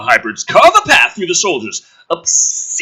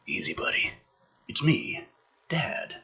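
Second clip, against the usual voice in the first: soft whisper, much softer; whispered father; 15 dB quieter than usual. Acted. Father soothing his frightened son, soft whisper. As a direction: hush a scared child.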